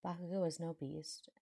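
ordinary delivery, nothing marked

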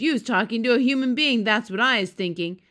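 loud, fast giant's challenge; booming accusation. A narrator's reading. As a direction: boom it out accusingly. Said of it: "he boomed".

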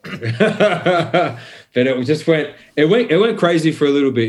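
amused half-laugh telling the story; laughing while telling. Real, not acted. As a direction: laugh through the memory.

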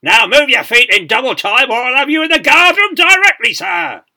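huge, high parade-ground roar; thunderous drill-square dressing-down; full bellow. A narrator's reading. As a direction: bellow like an outraged sergeant major. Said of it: "he bellowed".

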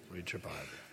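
"Read your Bible" low and hushed; very quiet aside. Real, not acted. Preacher repeating to congregation, "Read your Bible" as hushed aside. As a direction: drop "Read your Bible" almost to nothing.

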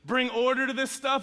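frustrated plea. Retold or imitated. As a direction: voice the congregation's exasperated question. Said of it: "Have y'all wondered that before?".

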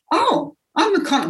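high, thrilled reveal; excited reveal. Retold or imitated. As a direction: announce it with delight. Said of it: "And I went".

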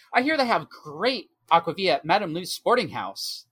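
very loudly, in character. Acted. Crewman resuming his hint to companions, a touch loud and pointed. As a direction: say it for others to overhear. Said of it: "he's gonna say very loudly".

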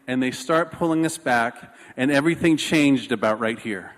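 ordinary delivery

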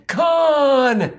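long, high-pitched movie-hero cry; dramatic yell. Acted. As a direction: draw the name out in a hammed-up yell. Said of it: "instead of yelling".

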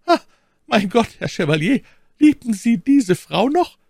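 high, loud, dismayed maid; erschrockene Ketty. Acted. Maid alarmed about a rival. (erschrockene, hohe Mädchenstimme)

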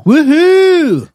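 loud, high woohoo; excited high cheer. Real, not acted. Cheering the new episode.

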